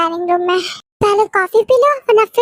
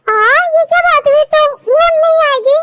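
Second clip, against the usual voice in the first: indignant surprise; 8 semitones higher, 3 dB louder than usual. Acted. Upset mom won't come.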